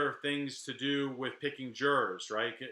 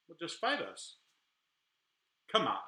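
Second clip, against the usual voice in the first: taunting gang; 4 semitones higher than usual. Retold or imitated. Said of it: "they talk crap to me".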